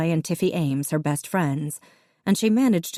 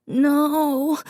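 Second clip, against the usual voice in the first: put-on a high, drawn-out child's wail; mild wail of a little boy; 11 semitones higher than usual. A narrator's reading. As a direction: cry it out much higher. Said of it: "Davy wailed".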